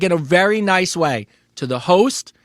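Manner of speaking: usual speaking voice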